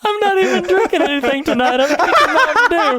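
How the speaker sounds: talking through high-pitched giggles; real laughter bubbling over every word; can barely get the words out for laughing; laughing hard. Real, not acted. Laughing at the joke.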